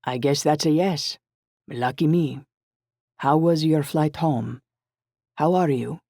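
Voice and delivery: put-on Italian-accented, solicitous phone voice; rich Italian accent. A narrator's reading. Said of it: "answered Raf in his rich Italian accent".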